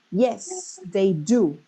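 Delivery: emphasize both "Yes" and "do"; deliberate answer with strong "Yes" and "do"; stress on YES, DO. Shown as a demonstration. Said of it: "they put a stress in both".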